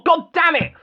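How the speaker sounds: frustrated outburst. Real, not acted. Botches the robot's name.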